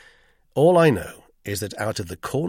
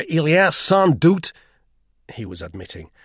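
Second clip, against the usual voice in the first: loud, high, struggling French; faltering admission in laboured French; halting French; 6 semitones higher, 7 dB louder than usual. A narrator's reading. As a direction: grope for French words and run out. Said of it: "He could get no farther".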